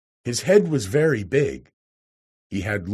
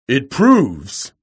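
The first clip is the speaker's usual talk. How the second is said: loud burst of anger; sudden angry roar; 3 semitones higher, 8 dB louder than usual. A narrator's reading. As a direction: roar it out furiously. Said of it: "he roared with a sudden anger".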